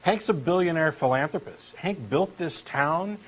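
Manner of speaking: ordinary talk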